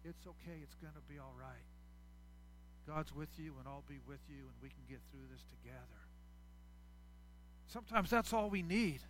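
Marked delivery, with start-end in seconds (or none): 0.0-6.1 soft, quieter reassurance; gentle comfort. Retold or imitated. A friend comforting someone who shared their heart, softly and gently. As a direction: soothe a hurting friend softly. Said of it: "put their arm around you and say".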